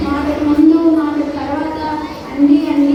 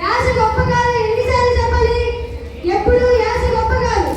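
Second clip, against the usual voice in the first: higher-pitched, scolding the noisy youngsters; scolding elder; 7 semitones higher than usual. Acted. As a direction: tell them off like a fed-up elder. Elder keeps scolding about language. (తిట్టే పెద్దమనిషి గొంతు)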